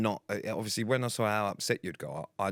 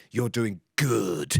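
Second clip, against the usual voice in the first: slow, louder, drawn-out "doing good"; intense low coaching voice; 5 dB louder, much slower than usual. Retold or imitated. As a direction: pushes the praise hard, like a gruff coach. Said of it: "He was doing this low voice".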